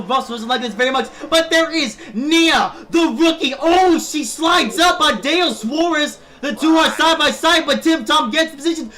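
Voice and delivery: shouting, excited live play-by-play; race commentator. Acted. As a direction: call the race at full hype.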